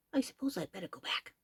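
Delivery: soft resigned sigh. A narrator's reading. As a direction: give in with a sigh. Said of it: "sighed the elephant".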